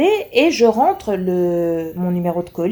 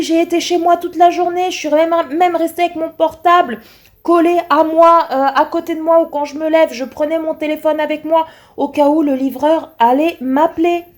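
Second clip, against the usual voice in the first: higher, indignant complaint; angry retelling; 7 semitones higher, 4 dB louder than usual. Retold or imitated. Customer scolding the delivery firm, higher. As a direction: pile up the grievance, higher. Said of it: "Paula en colère".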